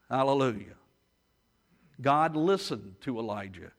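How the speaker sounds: usual speaking voice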